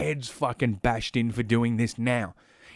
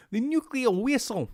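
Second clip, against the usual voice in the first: put-on much higher Russian-accented Chekhov; Chekhov impression; 12 semitones higher than usual. Streamer still in the Chekhov Russian accent. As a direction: squeak out the Russian line. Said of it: "why am I doing the new Chekhov".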